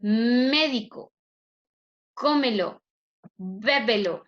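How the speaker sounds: "Médico", "cómelo", "bébelo" separately, first syllable punched; antepenultimate stress. Shown as a demonstration. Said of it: "mayor fuerza de voz en la antepenúltima".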